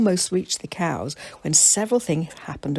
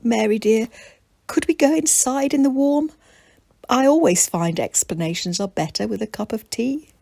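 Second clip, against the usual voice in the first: granny's voice; 6 semitones higher, 3 dB louder than usual. Acted. Old granny speaks calmly.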